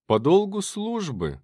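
high, drawn-out mocking reply. A narrator's reading. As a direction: answer with exaggerated slow irony. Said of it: "насмешливо ответил Штирнер".

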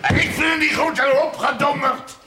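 high, loud furious shout; father shouting down his son's request; angry shout. Acted. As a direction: bellow the refusal and throw him out. Father refuses son, orders him out.

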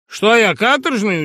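high, loud, slow indignant wonder; mock surprise. Sharikov is surprised by the professor's word, loud. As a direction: act astonished at the insult. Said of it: "удивился человек".

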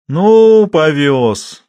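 loud, high, drawn-out jeer; mocking. A narrator's reading. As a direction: scoff loudly, swooping down from high. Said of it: "насмешливо сказал Жуков".

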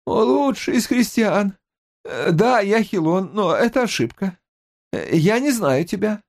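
high frightened babble; frightened babbling. A narrator's reading. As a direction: babble in fear. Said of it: "лепетал Хилон". (испуганный лепет)

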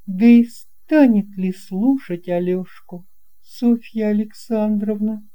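ordinary delivery, nothing marked

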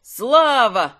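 high, loud acclaiming shout; crowd cheer. A narrator's reading. Audience taking up the cheer for the hero, shouting. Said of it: "подхватил зал".

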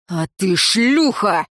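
slow, heavy male voice, lightly voiced; медленный тяжёлый рявк; snapped retort. A narrator's reading. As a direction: bite the insult out slowly. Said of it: "рявкнул Риор".

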